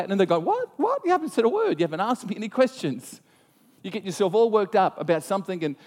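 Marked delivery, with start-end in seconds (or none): 0.4-3.2 much higher, startled "What? What?"; shocked disbelief. Retold or imitated. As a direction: react in stunned disbelief, pitch leaping. Acted shocked reply.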